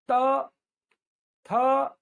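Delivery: त and थ said alone, loud, tongue tapping the teeth; model tooth sounds. Shown as a demonstration. Teacher asks class to repeat त थ, loud and clear. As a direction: drill त, थ with a clear tooth tap. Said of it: "एक बार बोलिए".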